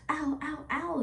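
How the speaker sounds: put-on high, soft little-girl ow; imitated child's ouch. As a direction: lightly mimic a small child hurting. Retells daughter's pain in bath.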